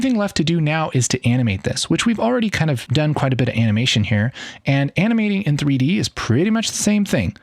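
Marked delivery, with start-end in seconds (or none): none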